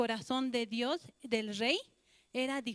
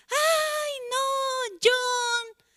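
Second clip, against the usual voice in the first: much higher, much louder wail of grief; anguished scream; 13 semitones higher, 8 dB louder, much slower than usual. Acted. Mother screaming over her son's body at the morgue. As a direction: scream his name as if seeing his body. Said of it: "su mamá gritó muy fuerte".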